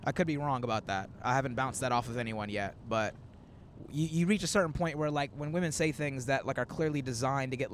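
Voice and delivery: ordinary talk, nothing marked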